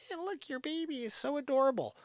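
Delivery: high-pitched mock cooing over a baby; cooing admirer. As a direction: imitate someone fawning over a newborn. Imitating people cooing over newborn.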